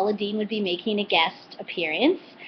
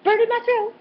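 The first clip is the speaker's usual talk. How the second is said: much higher, strained squeal at spicy sauce; mock burning-mouth yelp; 14 semitones higher, 4 dB louder than usual. Vlogger imitating Paula reacting to spicy sauce. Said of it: "Paula just went".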